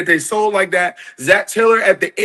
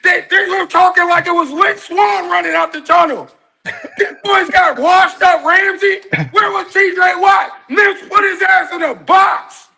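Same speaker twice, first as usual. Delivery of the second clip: excited shout; 10 semitones higher than usual. Real, not acted. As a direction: shout it out, fired up. Caller rants about Steelers fans.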